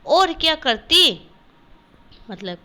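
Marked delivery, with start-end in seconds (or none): 0.1-1.2 higher, louder "what else could she do"; mother retorts to daughter, higher and louder; mother's retort. Acted. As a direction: toss it back at the child. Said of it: "माँ".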